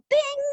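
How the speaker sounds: very high playful bing; bright ding. Retold or imitated. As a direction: pop the sound like a light switching on. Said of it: "I watched her go".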